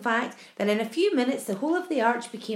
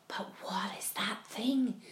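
slow, breath-only whisper; 2 semitones lower, 9 dB quieter, slower than usual. A narrator's reading. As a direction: whisper the question in awe. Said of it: "whispered the ladybird".

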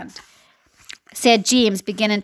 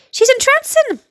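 a much higher, delighted exclamation; excited cry; 14 semitones higher, 4 dB louder than usual. Acted. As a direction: gasp it out, enchanted. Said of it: "The cops cried".

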